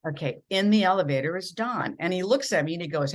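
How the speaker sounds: ordinary delivery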